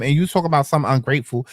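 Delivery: ordinary talk, nothing marked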